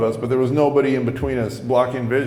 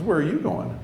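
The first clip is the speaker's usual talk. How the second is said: higher-pitched, puzzled query; raised pitch, simply asking; curious question; 6 semitones higher, 4 dB quieter than usual. Retold or imitated. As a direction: ask it lightly, imitating a curious girlfriend. Girlfriend's first, curious question.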